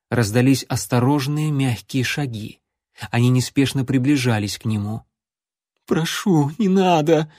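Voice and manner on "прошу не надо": pleading babble. A narrator's reading. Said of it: "пролепетал юнец и заплакал".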